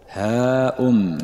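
drawn-out, restrained call back; mild answering call. A narrator's reading. The Prophet answers the Bedouin's call in like voice, a mild drawn-out call. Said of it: "نحوا من صوته".

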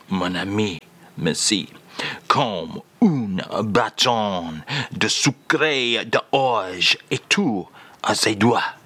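slow, low French phrase. Acted. Host asks [name] for the French phrase, slow low French. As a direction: deliver the French line slowly and low. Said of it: "Take it away, sir".

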